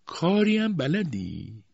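high, very slow, stretched question; imperious interrogation. A narrator's reading. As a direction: probe her with haughty doubt. Aunt interrogates mother.

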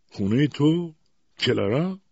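loud, high, incredulous challenge; harsh question. A narrator's reading. Husband challenging his wife's claim to the house, harsh questioning. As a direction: throw the question back harshly. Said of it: "با خشونت پرسید".

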